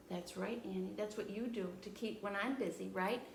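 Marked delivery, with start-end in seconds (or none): none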